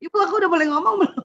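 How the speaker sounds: put-on high, rushed child's question; imitating eager child. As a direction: pipe up quickly like a child asking mother's permission. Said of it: "dia ngomong pakai mulut".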